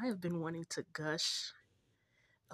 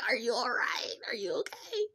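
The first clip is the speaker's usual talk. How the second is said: high, giggly check-in; laughing concern; 6 semitones higher, 5 dB louder than usual. Retold or imitated. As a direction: ask worriedly while cracking up. Repeats the check through laughter.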